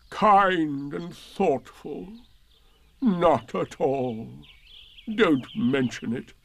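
slow gloomy donkey voice; Eeyore voice. Acted. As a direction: drag it out, gloomy and glum.

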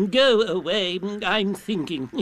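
grumpy owl shooing a caller, with hooting chuckle; slightly pompous owl character, words stretched out. A narrator's reading. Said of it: "Owl's head came out and said".

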